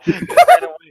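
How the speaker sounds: loud high burst of laughter; laughing out loud. Real, not acted. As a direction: crack up. Laughing at the god claim.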